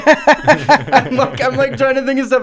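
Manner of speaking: laughing. Real, not acted. Cracking up at own riff.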